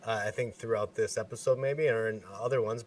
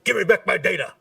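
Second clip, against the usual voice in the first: high, loud movie-hero shout; Mel Gibson yell; 9 semitones higher, 7 dB louder than usual. Retold or imitated. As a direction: bellow it like a desperate action hero. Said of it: "you're left there like Mel Gibson".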